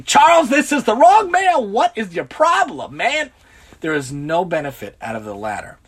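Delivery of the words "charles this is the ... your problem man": high, loud angry yell at the mailman; indignant shouted complaint, slowing on the question. Retold or imitated. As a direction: snap at him like he ruined your day. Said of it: "than, than yelling".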